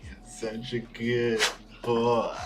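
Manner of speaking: slow, sing-song dog praise; talking to a dog. Real, not acted. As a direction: gush over the dog slowly. Baby talk praising the dog.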